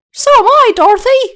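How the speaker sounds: high, loud happy reply; the Scarecrow greeting Dorothy, high and loud; cheerful scarecrow. Acted. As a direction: answer brightly back. Scarecrow replies.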